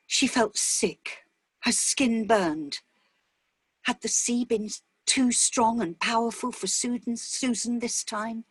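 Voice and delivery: ordinary delivery, nothing marked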